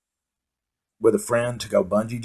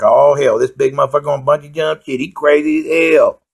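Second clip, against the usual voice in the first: mocking the bungee guy; 4 semitones higher, 4 dB louder than usual. Bungee operator fretting about the big guy, lightly mocked. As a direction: ham up the nervous operator. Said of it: "he saw me and was like".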